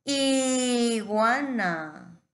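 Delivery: IGUANA very slow and loud, long held first I; drawn-out demonstration. Shown as a demonstration. Teacher showing the I at the start, IGUANA stretched. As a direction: stretch IGUANA so the opening I rings out. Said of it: "¿no es cierto? Está al principio".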